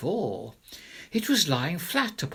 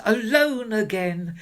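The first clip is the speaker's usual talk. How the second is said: gleeful cry; 7 semitones higher, 5 dB louder than usual. Acted. Child gloating alone.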